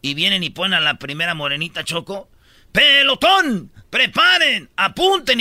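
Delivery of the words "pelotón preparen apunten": high, loud, drawn-out military commands; firing squad commander. Retold or imitated. Telling a joke, the officer commands the firing squad in a loud drawn-out voice. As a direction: bark the orders to the firing squad, slowly.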